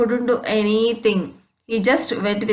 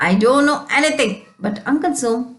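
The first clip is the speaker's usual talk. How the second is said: ordinary talk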